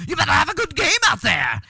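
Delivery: high, loud Irish lass voice; loud high-pitched Irish woman; Irish accent. Retold or imitated. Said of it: "she's, like, smacking his ass".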